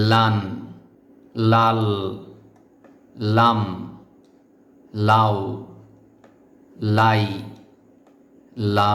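লান লাল লাম লাও লাই লা read out one by one with pauses; reading without spelling. Shown as a demonstration. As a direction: model reading of each syllable. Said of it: "এই লাইনটি বানান ছাড়া পড়তে পারেন কিনা". (বানান ছাড়া পড়া)

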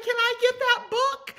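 high, loud eager ask; eager barista. Retold or imitated. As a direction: mimic the moved barista begging. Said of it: "She's like".

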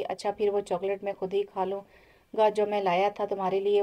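plain reading voice

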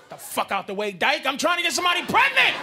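shouted high and loud; angry sperm. Retold or imitated. Sperm yelling at the egg to move, shouted. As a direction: shove through with a roar. Sperm shoving past eggs.

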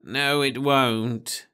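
slow, gentle sorrow; old Nibley breaking bad news, slow and sad; sadly. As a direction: let it out slowly and sadly. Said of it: "said Nibley sadly".

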